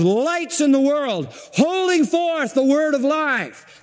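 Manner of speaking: high and very slow, each word stretched out; slow climactic emphasis. Acted. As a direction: lift the verse up slowly to its peak. Climax of the quoted verse.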